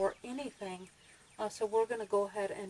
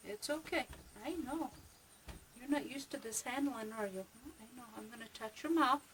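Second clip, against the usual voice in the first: soothing a rabbit; 3 semitones higher, 6 dB quieter than usual. Real, not acted.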